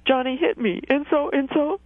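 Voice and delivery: high-pitched imitation of a complaining child; child's tattling. Linguist showing how children say it, high child's tattling. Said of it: "children will say".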